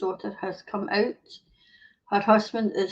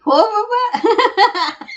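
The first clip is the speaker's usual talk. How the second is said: cracking up on the punchline; real giggle fit at own joke; laughing; 10 semitones higher, 7 dB louder, much slower than usual. Real, not acted. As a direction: laugh through the words, then a long laugh.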